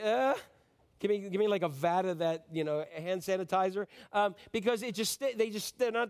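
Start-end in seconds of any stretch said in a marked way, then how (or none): 0.0-0.4 disgusted ugh. Retold or imitated. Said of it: "you're like".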